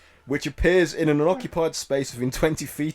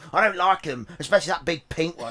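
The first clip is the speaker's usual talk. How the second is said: rude goblin voice. Acted. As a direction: spit insults like a spiteful goblin. Goblin insults the party.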